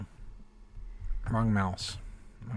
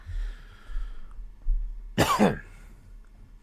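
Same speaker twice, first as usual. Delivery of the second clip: rough dry cough, throat scratchy; 11 semitones higher than usual. Real, not acted. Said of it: "Throat's dry".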